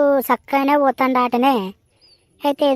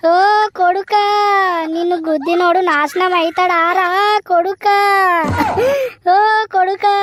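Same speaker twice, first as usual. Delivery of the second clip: higher, sobbing wail with rising calls of కొడుకా; crying lament; 5 semitones higher than usual. Acted. Mother cursing the driver who hit her son, sobbing wail. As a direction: cry and curse the driver in one breath. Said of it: "అయ్యో, ఊకో, ఊకో".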